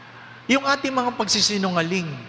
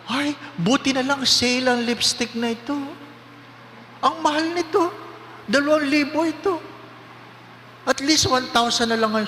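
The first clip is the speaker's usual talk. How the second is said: higher, chatty shopper voice; gossiping shoppers; 6 semitones higher than usual. Retold or imitated. Women at Watsons chatting about lipstick. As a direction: brag about the bargain. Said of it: "nag-uusap".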